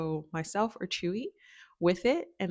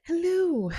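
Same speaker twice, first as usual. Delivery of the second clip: squeaky high greeting, much louder; high sing-song hello; 13 semitones higher, 9 dB louder than usual. Real, not acted. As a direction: chirp it up high to the pups. Greeting the dogs on camera.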